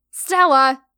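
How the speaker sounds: loud sharp scold. Acted. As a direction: scold her by name. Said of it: "I scolded".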